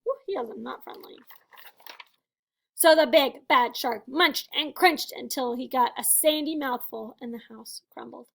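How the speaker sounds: usual speaking voice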